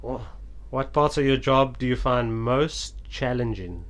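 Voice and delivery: ordinary talk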